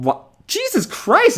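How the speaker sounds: real astonishment, very high and drawn out; slow, high burst of amazement; amazed outburst. Real, not acted. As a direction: exclaim in high-pitched disbelief. Reacts amazed at pizza recipe.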